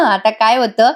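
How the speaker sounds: ordinary delivery, nothing marked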